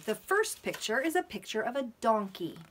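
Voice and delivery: usual speaking voice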